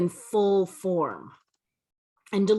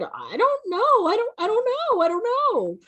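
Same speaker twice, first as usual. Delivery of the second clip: flustered. Retold or imitated. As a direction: stammer it like you never considered it. Caught off guard by the question.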